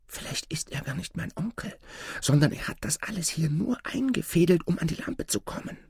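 high, hushed, anxious musing; uneasy thought. A narrator's reading. Boy doubts his supposed uncle, high hushed unease. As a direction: think aloud in a thin high half-whisper. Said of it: "wurde es dem Jungen unheimlich".

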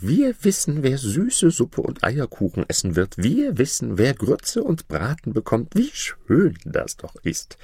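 hohe, schwärmerische Hofdamenstimme; Hofdamen. Acted. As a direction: kichernde Hofdamen, hoch und begeistert. Court ladies cheering. (Hofdamen jubeln, hohe Stimme)

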